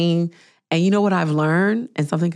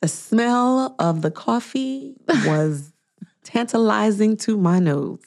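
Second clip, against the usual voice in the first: slow, drawn-out savoring; lingering dramatically as if inhaling the aroma; 5 semitones higher, slower than usual. Real, not acted. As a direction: stretch the words out, relishing each one. Savoring a remembered coffee smell.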